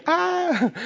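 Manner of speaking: high, drawn-out comic "ah" of panic; mock scream. Preacher acting out Zacarías's terror at the angel, mock scream.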